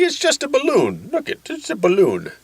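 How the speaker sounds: airy doctor making light of it; high mock doctor voice. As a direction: brush it off like a cheerful doctor. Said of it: "He's like".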